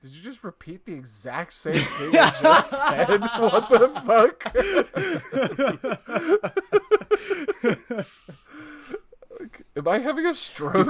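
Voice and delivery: loud high laughing disbelief; incredulous laughing outburst. Real, not acted. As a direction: shout it, cracking up in disbelief. Stunned that a friend repeated him.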